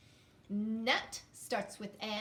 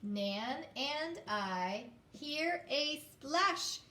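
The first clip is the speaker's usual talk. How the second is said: very slow, word by word, with the class; slow choral reading; 3 semitones higher, much slower than usual. Shown as a demonstration. Teacher reading in unison with students. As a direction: read it together slowly. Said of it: "Can you do it with me now".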